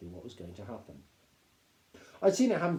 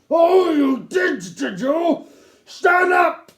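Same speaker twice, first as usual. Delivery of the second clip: high, loud, slow mocking roar; furious shout; 16 dB louder, much slower than usual. A narrator's reading. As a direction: bellow the order. Captain roars at second boy.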